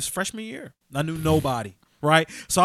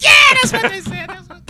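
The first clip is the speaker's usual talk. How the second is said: loud, very high shriek of delight; delighted grandma; 14 semitones higher, 10 dB louder than usual. Retold or imitated. Grandma repeating the insult with delight, loud and high. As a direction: cackle it like she loves it. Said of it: "she'd be like".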